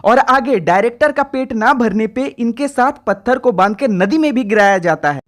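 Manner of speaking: ordinary talk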